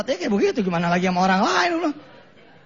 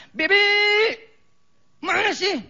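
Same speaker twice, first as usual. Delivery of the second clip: put-on louder and higher, a bossy child calling the maid; spoiled child demanding; 12 semitones higher, 4 dB louder than usual. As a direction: demand it like a pampered kid.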